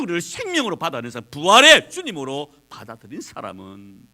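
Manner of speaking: rising preacher's proclamation; building preaching. Acted. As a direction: drive the verse home to the church. Explains the verse forcefully.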